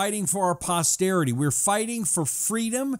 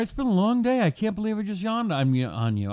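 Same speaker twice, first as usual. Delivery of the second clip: normal talking voice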